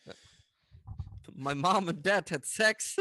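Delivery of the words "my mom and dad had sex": put-on slow, loud American accent in English; drawled American accent line. Mit seinem geschützten amerikanischen Akzent.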